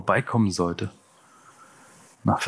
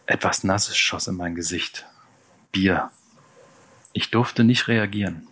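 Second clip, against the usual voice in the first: usual speaking voice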